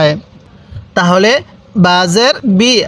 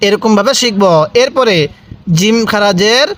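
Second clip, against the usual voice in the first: ordinary talk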